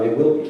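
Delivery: emphatic, certain "will"; stress on WILL. Real, not acted. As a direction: firmly correct yourself, punching "will". Corrects own "should" to "will.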